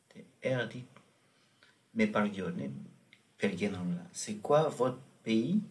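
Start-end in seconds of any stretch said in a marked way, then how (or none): none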